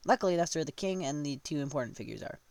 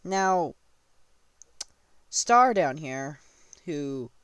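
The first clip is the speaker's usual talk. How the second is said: plain reading voice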